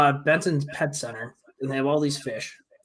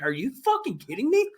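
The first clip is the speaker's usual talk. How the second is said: much higher, incredulous retold outburst; replaying his disbelief at the clerk, pitched way up; mock exasperation; 12 semitones higher than usual. Retelling his reaction to the pet store guy, high and incredulous. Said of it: "I'm like".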